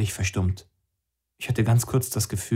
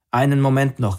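a touch louder call; mildly raised hurried call; 4 semitones higher, 4 dB louder than usual. Acted. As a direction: call out to stop them leaving. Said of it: "rief Eugene".

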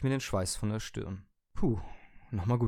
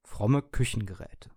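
ordinary delivery, nothing marked

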